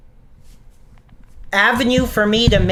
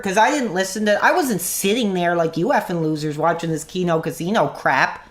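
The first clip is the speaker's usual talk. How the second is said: usual speaking voice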